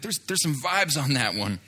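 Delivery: normal talking voice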